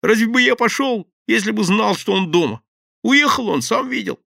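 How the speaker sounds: high, loud eager babble; relieved babbling. A narrator's reading. Thief eagerly pleads with the gang. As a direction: chatter on in relief. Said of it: "обрадованно залепетал".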